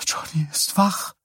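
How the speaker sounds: high, airy whisper of disbelief; stunned whisper. A narrator's reading. Wife repeats the news to her husband, stunned whisper. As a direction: whisper it, hardly believing.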